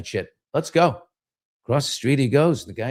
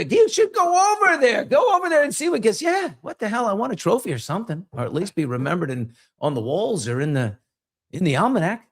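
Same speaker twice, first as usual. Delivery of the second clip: put-on drunk pal egging on; 7 semitones higher than usual. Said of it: "bar guys urging him".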